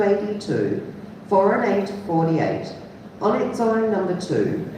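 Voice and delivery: bingo caller. Real, not acted. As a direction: call the numbers like a bingo caller. Bingo number calling.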